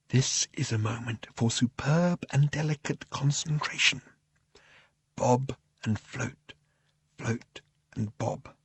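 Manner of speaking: very soft, slow and delicate; hushed concentration. A narrator's reading. Narrator guiding a novice flyer through superb and delicate concentration, hushed. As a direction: float each word out gently, with space around it.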